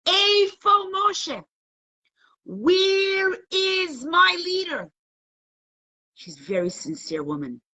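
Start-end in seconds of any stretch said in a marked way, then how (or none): 0.1-4.9 high, drawn-out acted wail; crying out. Wife crying in her kitchen for a leader, imitated anguished cry. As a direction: call out loudly for a lost leader, stretching every word. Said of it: "she's crying in her kitchen".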